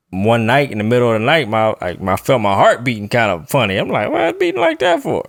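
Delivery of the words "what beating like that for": high squeaky mock alarm. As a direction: jump way up into a squeaky, puzzled voice. Alarmed at own heartbeat at night.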